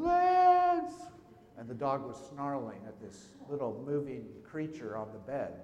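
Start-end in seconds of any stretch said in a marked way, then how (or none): 0.0-0.9 sudden high, loud yell of a name; panicked shout across the house. Retold or imitated. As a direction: call him urgently from the house. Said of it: "Hawk's mom started yelling".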